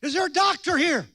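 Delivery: calling for a doctor. Retold or imitated. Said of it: "Guy says".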